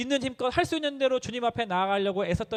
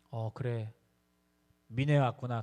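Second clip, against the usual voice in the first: put-on confused old father; 7 semitones lower, slower than usual. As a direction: murmur it like a dazed father. Son voices his confused father.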